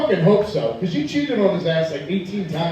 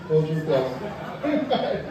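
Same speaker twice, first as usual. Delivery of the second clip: slow, laughing; 2 semitones lower, 7 dB quieter, much slower than usual. Shown as a demonstration.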